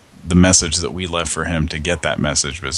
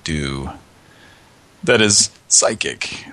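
ordinary talk, nothing marked

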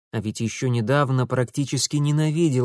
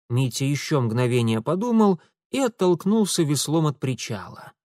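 plain reading voice

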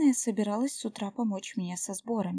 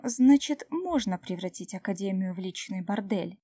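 ordinary delivery

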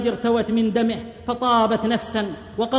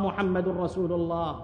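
much softer and lower, dying away; fading last breath; 6 semitones lower, 9 dB quieter than usual. Acted. Martyr's final words as his soul departs, fading to nothing. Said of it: "ثم فاضت روحه وهو يردد".

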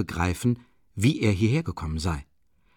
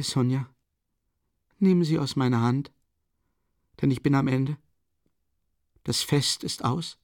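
high, frail voice of a dying mother; dying woman voice; 5 semitones higher than usual. A narrator's reading. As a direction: plead feebly with the children. Dying mother speaks in story.

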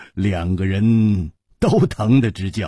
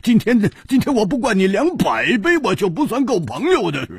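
very high, boisterous insistence; blustering vow; 11 semitones higher than usual. A narrator's reading. Drinker vowing to drown his friend in drink, blustering. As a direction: boast at the top of the range. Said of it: "胡铁花瞪着眼说".

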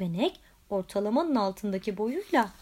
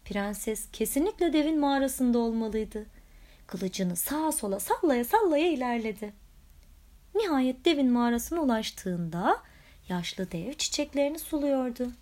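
ordinary delivery, nothing marked

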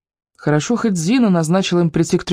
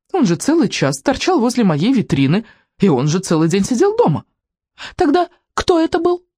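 cross saleswoman; 5 semitones higher than usual. Acted. Angry saleswoman accusing.

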